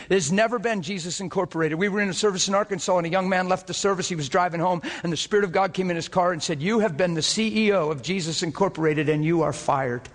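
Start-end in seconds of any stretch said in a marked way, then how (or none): 1.8-10.1 fired-up revival preacher; preaching at full force. Acted. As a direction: preach it out with growing fire.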